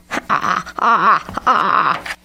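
cawing ha ha ha; the crow laughs at Jeb; mocking crow laugh. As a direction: laugh at him as you fly off. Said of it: "away he flew, laughing".

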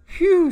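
very high, loud "phew"; whistle-high sigh of relief; squeaky exhale of relief; relieved phew. A narrator's reading. Relieved character in story.

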